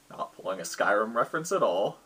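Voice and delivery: usual speaking voice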